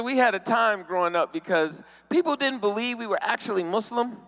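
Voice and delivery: usual speaking voice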